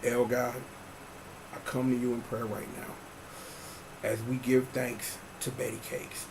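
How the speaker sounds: low, hushed preacher prayer; mock prayer. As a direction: pray softly over the congregation.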